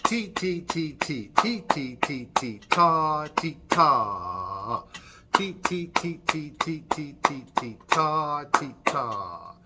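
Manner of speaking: chanted ti and ta beats; teacher demonstrating a rhythm for children; rhythm demo. Shown as a demonstration. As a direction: chant a rhythm for them to copy. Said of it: "One more time".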